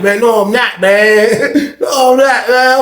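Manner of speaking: high, laughing retold denial; giggling denial. Husband denying he's high to his wife, giggling. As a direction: imitate denying it while giggling. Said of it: "I'm laughing, giggling".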